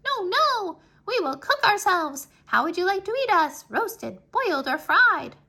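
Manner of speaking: high, loud squeak; squeaky rice grains. A narrator's reading. Rice grains squeaking at hungry Anansi, high and loud. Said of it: "the other rice grains squeaked".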